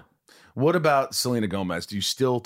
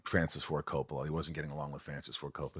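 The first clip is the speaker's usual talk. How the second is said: ordinary talk, nothing marked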